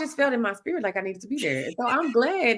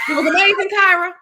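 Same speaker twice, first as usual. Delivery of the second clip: excited; 8 semitones higher, 8 dB louder than usual. Real, not acted. Excited praise to friend.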